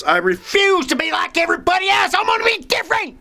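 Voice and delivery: high-pitched mock protest voice; mock defiance. Mock defiant outburst about conformity.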